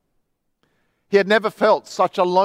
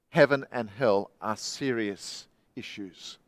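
solemn sermon appeal; 6 semitones lower, 4 dB quieter than usual. Acted. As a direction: lower the voice and plead with the listeners.

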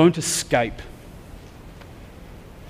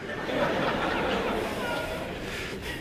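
real laugh; 13 dB quieter than usual. Real, not acted. Laughing at his own story.

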